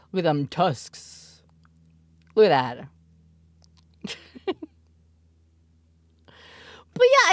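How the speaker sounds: ordinary talk, nothing marked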